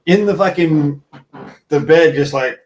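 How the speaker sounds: usual speaking voice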